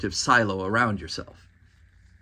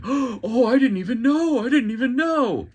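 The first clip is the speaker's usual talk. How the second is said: much higher, hammed-up shocked innocence; fake surprise; 11 semitones higher, 4 dB louder, slower than usual. Mocking news anchors on election night, in much higher hammed-up fake surprise. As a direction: play the pundit pretending to be shocked, way up high. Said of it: "this fake feigning".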